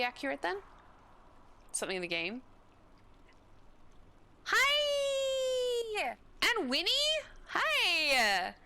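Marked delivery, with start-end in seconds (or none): 4.4-8.5 very high, loud shouted hi; squealed greeting; excited hi. Real, not acted. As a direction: call out to a friend joyfully.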